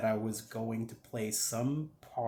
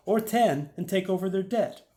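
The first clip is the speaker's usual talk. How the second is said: higher, louder sales pitch; pitching Dan; 9 semitones higher, 8 dB louder than usual. Acted. Dan offers the narrator a cheaper deal, pitched up and loud. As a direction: push the offer in a raised voice.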